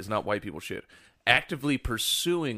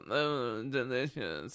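mock delight through a frozen face, softly; stiff-faced food taster; 7 dB quieter, much slower than usual. Imitating a video woman pretending to eat, stiff-faced delight. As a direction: softly fake savouring a bite, lips barely moving. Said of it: "but she can't move her face".

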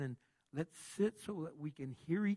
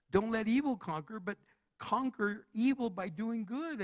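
emphatic restatement; 6 semitones higher, 5 dB louder than usual. Shown as a demonstration. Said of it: "that's what it's saying there".

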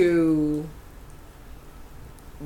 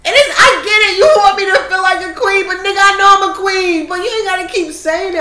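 high-pitched exasperated protest; real exasperation, pitch held high; annoyed; 11 semitones higher, 5 dB louder than usual. Real, not acted. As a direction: plead your case, fed up. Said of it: "I don't know why that annoys me so much".

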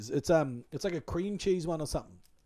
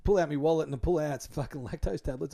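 ordinary delivery

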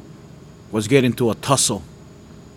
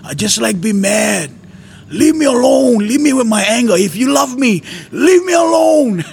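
high, loud shouts of leave me alone; furious yelling; 10 semitones higher, 4 dB louder than usual. Retold or imitated. Man yelling at the Lord to leave him, high, loud shouting. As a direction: yell at God to be left alone. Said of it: "I'm telling him".

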